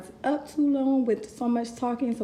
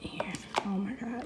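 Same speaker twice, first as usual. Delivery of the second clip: breathless whispered gasp of wonder; hushed oh my God at the unboxing; awed whisper; 4 dB quieter than usual. Real, not acted. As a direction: whisper it as if stunned. Awed reaction opening the case.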